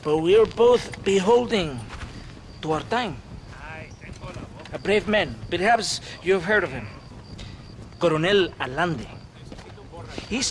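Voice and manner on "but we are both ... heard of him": usual speaking voice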